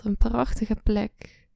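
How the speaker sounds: ordinary delivery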